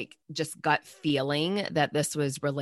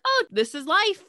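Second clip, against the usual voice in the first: dismissive doctor; 3 dB louder than usual. Retold or imitated. Interviewer voicing dismissive doctors.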